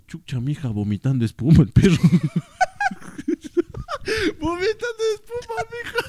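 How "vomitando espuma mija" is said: high breathless laughter through the words; laughing so hard it almost turns to crying; cracking up while telling it. Real, not acted. Retelling dog poisoned, laughing.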